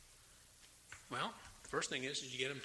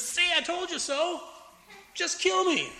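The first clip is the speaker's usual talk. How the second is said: much higher, shouted told-you-so; bitter loud outburst; 12 semitones higher, 10 dB louder than usual. Retold or imitated. Jonah shouting at God after Nineveh repents. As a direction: blow up in petulant despair.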